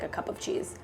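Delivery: hushed aside while measuring; whisper. Real, not acted. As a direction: whisper it to yourself.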